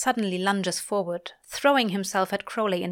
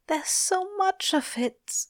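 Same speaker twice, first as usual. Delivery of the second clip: high, thin, slow lament; anguished whisper; 11 semitones higher, slower than usual. A narrator's reading. Angel grieving in Crowley's arms, hushed anguish. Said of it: "Aziraphale whispers".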